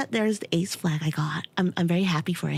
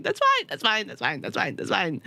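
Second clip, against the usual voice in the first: resigned that's fine. Retold or imitated. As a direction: give up, fading away. Resigned repetition.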